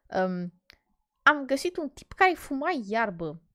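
ordinary delivery

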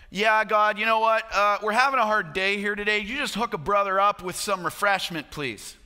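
mock a breezy, offhand prayer, dropping away at the end; acting out a flippant plea. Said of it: "which isn't some passing, flippant".

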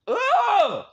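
a loud, high "ugh!" of disgust; disgusted groan. Real, not acted. Recoiling from a weird stranger on camera, a loud, high disgusted groan.

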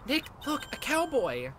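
high, loud excited squeal. Acted. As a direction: point him out in a thrilled rush.

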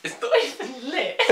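high, giggling through the words; laughing. Real, not acted. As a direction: crack up while saying it. Cracking up over prop.

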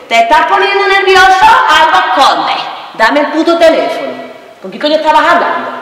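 high-pitched angry accusing; hammed-up furious suspicion; jealous interrogation. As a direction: demand answers, voice shooting up. Acted jealous interrogation over phone.

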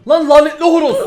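indignant yelp at a game animal; yell at rooster. Real, not acted. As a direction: shout at the cursed rooster. Yelling at cursed rooster.

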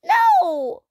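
put-on high, loud child's shriek of protest; piercing, drawn-out cry. A narrator's reading. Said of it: "Sophie shrieked".

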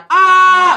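high, loud "aah"; sudden high yell, imitating an accident victim; mock cry of pain. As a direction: yelp loudly as if just hit. Mimics a crash victim's cry.

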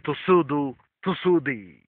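Hebrew "Tusudu, tusudi" said carefully, syllable by syllable; Hebrew words. Shown as a demonstration. Preacher teaching the congregation the Hebrew words, carefully sounded out. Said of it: "a great command in the Hebrew".

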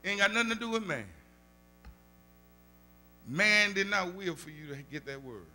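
ordinary delivery

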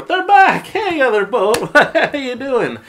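high, loud, hammed-up cheer; mock excited sailor greeting. Mocking movie sailors greeting the other boat, loud hammed-up cheer. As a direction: overplay a delighted sailor spotting the boat.

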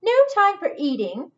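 loud, high, sharp interruption; breaking in. Acted. Squirrel interrupting the cook, loud and impatient. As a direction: cut in impatiently.

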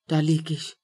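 ordinary delivery

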